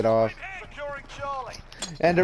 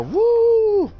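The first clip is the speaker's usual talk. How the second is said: high, loud "woo" whoop; excited yell; 5 dB louder than usual. Retold or imitated. Rider cutting loose on a carnival ride, loud whoop. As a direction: let out a long, high-pitched ride whoop. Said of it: "I started yelling".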